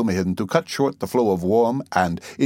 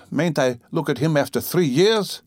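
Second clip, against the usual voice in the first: understated tearful plea, high-pitched; mildly tearful, higher voice; weeping; 7 semitones higher than usual. A narrator's reading. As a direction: plead as if close to tears. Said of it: "wept Pulcheria Alexandrovna".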